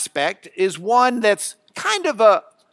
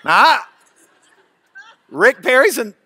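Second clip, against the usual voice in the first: ordinary talk, nothing marked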